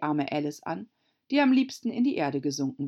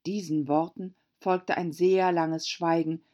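plain reading voice

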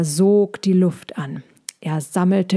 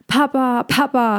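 higher calls to his father; calling out; 6 semitones higher than usual. Acted. Young dragon calling out to his papa. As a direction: call out across the clearing. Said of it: "rief Balbi".

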